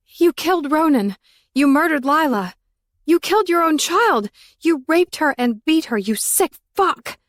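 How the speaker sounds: wife accuses husband of murder, furious and crying; furious crying accusation. A narrator's reading. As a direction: hurl the accusations through tears. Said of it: "I cried even through my anger".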